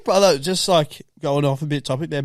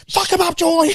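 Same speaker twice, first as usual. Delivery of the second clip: lightly voiced nan cheering, higher; 5 dB louder than usual. Nan cheering at the telly, a hint of a rowdy grandma. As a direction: egg the contestant on like a fired-up nan. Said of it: "She's getting right in".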